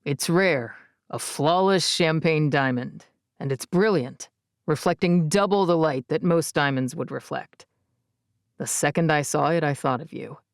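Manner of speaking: low, steady man's voice; male character voice. A narrator's reading. Easton voiced by narrator.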